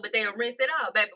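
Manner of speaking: usual speaking voice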